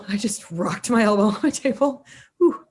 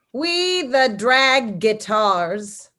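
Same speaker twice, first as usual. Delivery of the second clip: slow, drawn-out mock-proclamation; annoying voice; much slower than usual. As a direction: read it like an annoying, pompous manifesto. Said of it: "read it in this annoying voice".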